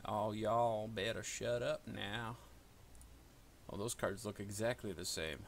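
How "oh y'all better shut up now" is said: slow drawled Appalachian twang; slow hillbilly warning; hillbilly accent. Pretend. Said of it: "Why am I talking like a hillbilly?".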